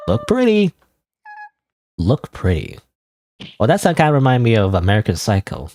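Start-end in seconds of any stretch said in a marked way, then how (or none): 0.1-0.7 much higher, lilting "look pretty"; high-pitched read. Acted. Gamer reading out the game's dialogue, high-pitched. Reading a game line two ways. 2.0-2.8 low deadpan repeat. Acted. Gamer repeating the game's line to himself, low-pitched.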